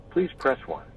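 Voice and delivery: ordinary talk, nothing marked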